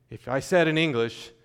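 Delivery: usual speaking voice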